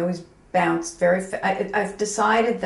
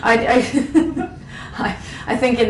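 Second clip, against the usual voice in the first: breaking into a real laugh, higher and louder; 6 semitones higher, 6 dB louder than usual. Real, not acted. Designer laughing at her own wish list. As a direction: laugh through the words, embarrassed.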